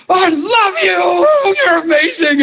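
overwhelmed shouting praise; tearful shout. Retold or imitated. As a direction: pour it out to Jesus, crying and shouting. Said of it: "And I was like".

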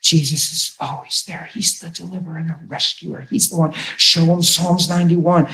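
ordinary talk

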